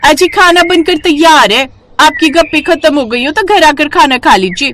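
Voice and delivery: a wife calling her husband in to eat. Acted. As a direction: call the men home for dinner. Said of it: "आवाज देते हुए कहती हैं".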